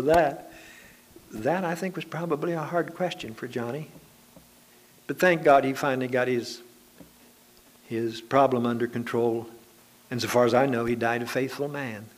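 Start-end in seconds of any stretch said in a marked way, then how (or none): none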